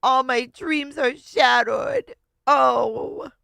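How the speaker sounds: high, loud, slow melodramatic wail; hammed-up heartbreak, mimicking a drama queen; mock lament. Host mockingly echoes the storyteller's lament.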